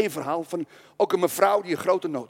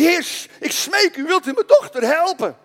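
a desperate mother's high cry for help; pleading mother; 8 semitones higher, 6 dB louder than usual. Acted. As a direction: beg on your knees for your daughter. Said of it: "viel die mevrouw op de knieën en zei".

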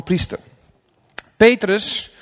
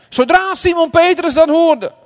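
loud, very high declamation; impassioned preaching; 12 semitones higher, 7 dB louder than usual. A narrator's reading. Preacher relates Peter hearing the news, impassioned and loud. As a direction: proclaim it at full force.

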